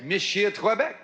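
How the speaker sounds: ordinary talk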